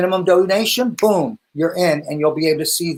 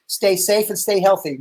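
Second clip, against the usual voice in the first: ordinary delivery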